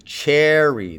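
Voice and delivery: cherry with CHE held and strong; slow teacher's cherry, stressed at the front; first-syllable stress. Shown as a demonstration. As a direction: lean on the CHE of cherry, let the end fall. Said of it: "with a stress on the first syllable".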